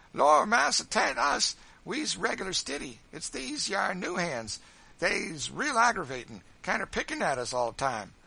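high, loud protesting whine; clownish, complaining excuse-maker; wheedling Sambo. A narrator's reading. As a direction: plead innocence to the boss, loudly.

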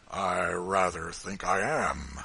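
slow, loud and firm, every word weighted; rough farmer's slow, emphatic declaration; with considerable emphasis. Acted. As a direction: plant your feet and mean it. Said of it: "with some considerable emphasis".

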